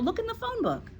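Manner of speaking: put-on very high-pitched little boy; schoolboy voice. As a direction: pitch it high as a young pupil. Eric answers in boy voice.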